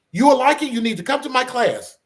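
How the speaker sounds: a high, loud imitation of a thick Russian accent; mimicking the Russian instructor's insistent invite in a Russian accent. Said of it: "she has that thick Russian accent".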